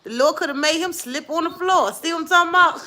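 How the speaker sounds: whooping it up over the husband in the shower; excited teasing. Real, not acted. Mother teasing her daughter on the phone, excited and high.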